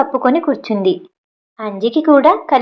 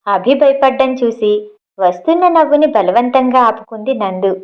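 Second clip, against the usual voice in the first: plain reading voice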